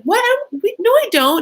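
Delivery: flustered denial. Retold or imitated. Younger sister denies her crush in front of the boy, flustered. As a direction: blurt it out, caught off guard.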